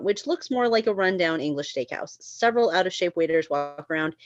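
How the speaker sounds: ordinary delivery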